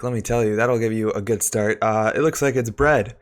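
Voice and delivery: normal talking voice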